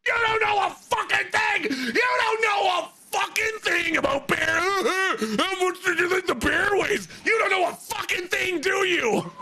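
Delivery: mock angry yell. Fred yelling at her about bears, imitated shouting rant. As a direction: belt it out like a boss chewing you out.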